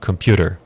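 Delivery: "computer" said as "compyooder"; D in place of T. Shown as a demonstration. Teacher showing as if a D were there, D-like T. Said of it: "como se um D estivesse ali".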